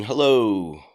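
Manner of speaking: usual speaking voice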